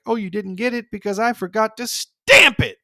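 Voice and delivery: put-on high, bratty kid voice; cheeky Bart. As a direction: tease him with fake innocence. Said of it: "And he's like".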